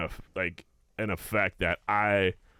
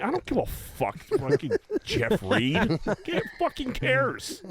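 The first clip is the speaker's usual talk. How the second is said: mocking rant; 12 semitones higher, slower than usual. Ranting dismissal of celebrity.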